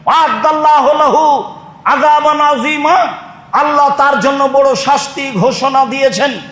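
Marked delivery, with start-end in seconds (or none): none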